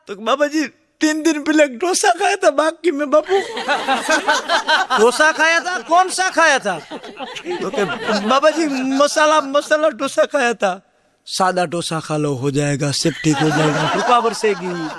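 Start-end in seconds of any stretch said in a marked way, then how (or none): 0.2-10.8 high, laughing, meek devotee in broken Hindi; timid devotee. Acted. Devotee confessing his meal to the TV baba, high and meek. 11.3-14.9 low, solemn baba blessing; pompous godman. Acted. TV baba blessing his devotee, low and solemn.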